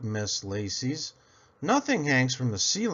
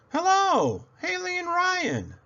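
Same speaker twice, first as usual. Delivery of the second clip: much higher, sing-song greeting; cheerful teacher hello. A narrator's reading. Kind teacher welcoming her old pupils, high and cheery. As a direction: greet the kids like a sunny teacher.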